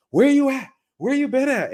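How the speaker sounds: high, loud mock nagging woman; sassy confronting girlfriend, hammed up; imitating an angry sister. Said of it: "You know how sisters like".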